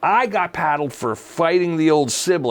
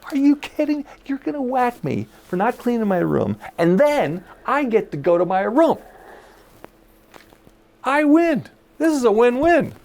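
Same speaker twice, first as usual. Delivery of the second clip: higher-pitched mock incredulity, relishing the supposed punishment; gleeful disbelief; 7 semitones higher than usual. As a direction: act out a kid who thinks they've won. Acts out delighted child being punished.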